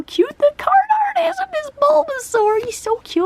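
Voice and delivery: high squeal of delight; squealing over cuteness. Real, not acted. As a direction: gush over it, pitch way up. Gushing over cute card art.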